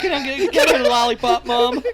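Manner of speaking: high, loud pretend child begging; mock pleading kid. Teasing as a child begging Mom for a treat, mock pleading. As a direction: wheedle like a kid after candy.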